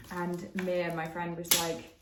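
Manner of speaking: ordinary talk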